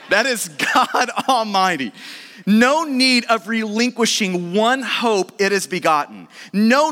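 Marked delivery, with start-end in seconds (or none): 0.1-1.9 drawn out with a laugh in it; slow, laughing emphasis. Acted. Preacher marvelling at God Almighty, laughing slowly. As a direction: savour each word, chuckling. Repeats the phrase with delight.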